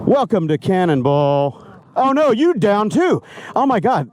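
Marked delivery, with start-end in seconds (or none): 2.0-4.1 high-pitched dismay; surprised. Real, not acted. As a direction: gasp it out, higher. Another rider falls.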